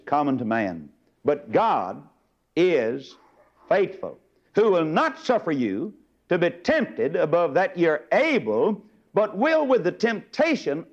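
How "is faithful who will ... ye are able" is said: normal talking voice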